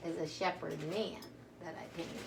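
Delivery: ordinary delivery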